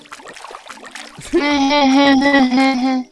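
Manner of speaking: laughing. Real, not acted. Teasing little sister.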